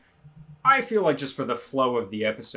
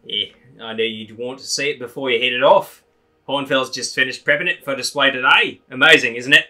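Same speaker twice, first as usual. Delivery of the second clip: louder, pushed character read; Hornfels voice; 2 semitones higher, 9 dB louder than usual. Shown as a demonstration. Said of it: "I'm gonna read this line as Hornfels".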